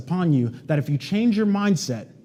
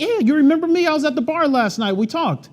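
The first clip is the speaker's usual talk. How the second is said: much higher, eager reminder voice; guy's eager voice; 11 semitones higher, 3 dB louder than usual. Retold or imitated. A stranger from the bar greets the agent eagerly. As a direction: play the guy reminding him of last night.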